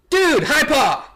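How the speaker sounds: high, loud hyped-up buddy call; hammed-up excited greeting. Werewolf greets a buddy with a pun, excited call. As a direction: shout it like offering a high five.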